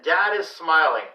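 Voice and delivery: usual speaking voice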